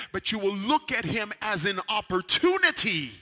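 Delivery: loud, high, driving preacher delivery; full-force preaching. Acted. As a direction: preach it hard to the congregation.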